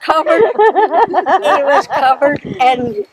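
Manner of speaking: talking through real laughter; can't stop laughing while telling it. Real, not acted. Grandmother laughing as she tells the ink prank.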